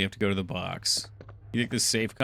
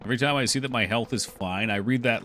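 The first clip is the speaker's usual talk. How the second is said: ordinary delivery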